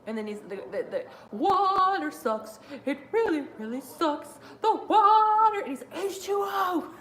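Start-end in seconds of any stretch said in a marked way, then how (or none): 1.3-5.6 high, drawn-out mock wail; imitated Waterboy freakout. Acting out The Waterboy, mock freakout. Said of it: "The Adam Sandler freakouts are great". 5.9-6.9 very high goofy holler; imitated Waterboy. Quoting The Waterboy for fun, imitated freakout. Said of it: "The Adam Sandler freakouts are great".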